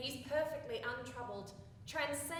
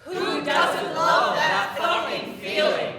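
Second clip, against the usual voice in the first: loud, slow, laughing cry of joy; ecstatic shout; 4 semitones higher, 18 dB louder than usual. Acted. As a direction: let it burst out, laughing. Ecstatic chorus climax.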